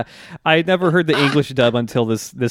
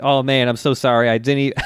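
normal talking voice

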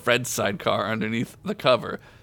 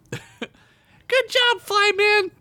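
put-on very high, emphatic kid voice; emphatic child voice; 3 dB louder, much slower than usual. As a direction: cheer like a tiny elf girl. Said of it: "Tina said emphatically".

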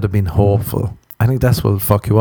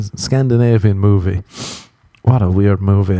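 ordinary talk

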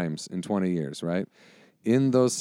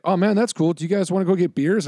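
high, louder, chummy fan voice; friendly rival fan; 9 semitones higher, 5 dB louder than usual. Retold or imitated. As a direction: imitate a fan turned suddenly buddy-buddy. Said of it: "and he was like".

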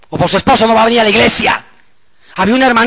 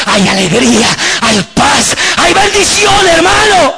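exultant preaching shout; 8 semitones higher, 5 dB louder, slower than usual. Real, not acted. Preacher proclaiming Christ's blessings to the church, exultant shouting. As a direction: build to a high, loud cry. Sermon climax listing blessings.

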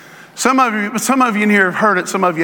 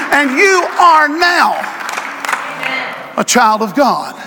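building sermon; 5 semitones higher than usual. Acted. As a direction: preach it with growing conviction. Preaching on the helmet.

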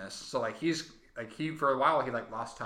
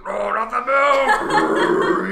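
long drawn-out roar, much louder and higher; Hulk roar; 7 semitones higher, 14 dB louder, much slower than usual. Acted. Hulk reacts to the milk, long hammed-up roar. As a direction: bellow it slowly as a transforming Hulk.

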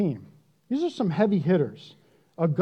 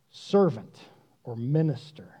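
usual speaking voice

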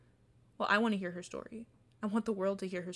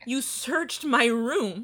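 loud, slow, every word hammered home; outraged; 7 dB louder, much slower than usual. Acted. Confronting the man who searched her room, loud and slow. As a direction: demand it as if she can't believe it.

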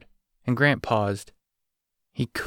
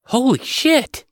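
much higher, loud startled yell; 5 dB louder, slower than usual. A narrator's reading. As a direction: blurt it out at full voice. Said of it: "she yelled".

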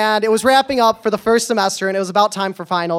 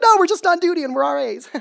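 high-pitched mock-innocent reply; playful high-voiced denial, dropping at the end; goofy protest; 9 semitones higher than usual. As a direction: pipe it up high and silly. Goofy reply to being asked if drunk.

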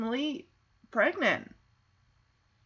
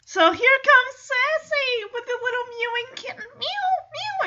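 very high squeaky kitten-like voice; mewing kitten; 13 semitones higher than usual. Retold or imitated. Narrator brings on Sassy with a mewing kitten, high squeaky mewing. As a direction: go way up high and mimic tiny meows.

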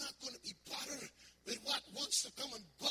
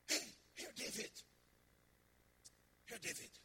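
ordinary talk

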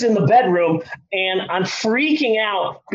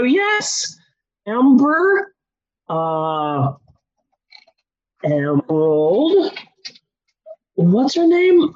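confused roll call; 4 semitones higher, much slower than usual. Retold or imitated. Confused roll call of his kids.

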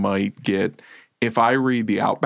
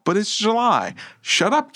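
put-on much higher, a bratty inner kid protesting; high mock kid voice; 11 semitones higher than usual. His own inner child whining about July. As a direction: squeak it like a whiny child.

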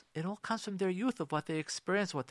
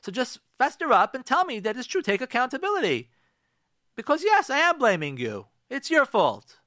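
high, loud accusation; forceful, high-pitched demand to take accountability; open blaming; 8 semitones higher, 6 dB louder than usual. Acted. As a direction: drop the mask and blame them outright. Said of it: "What I'm really saying to you".

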